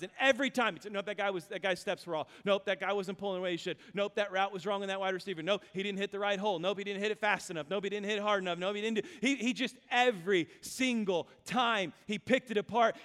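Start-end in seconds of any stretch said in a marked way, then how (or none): 0.9-9.1 quick, repetitive coach critique; brisk run of dismissals; rapid-fire rejections. Retold or imitated. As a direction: rattle off each fault without letup. Imitates coach rejecting play after play.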